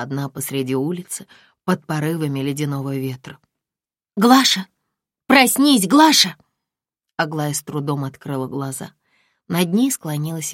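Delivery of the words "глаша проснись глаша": high loud call; calling to wake. Acted. Friend wakes the sleeping patient, calling loud.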